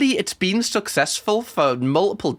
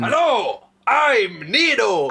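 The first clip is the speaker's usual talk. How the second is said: slow, higher, drawn-out mock greeting; imitating Nino; 5 semitones higher, much slower than usual. YouTuber mocking Nino greeting diners, slow higher mock voice. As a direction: ham up a smug, too-slow hello. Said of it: "going up to people eating their food and going".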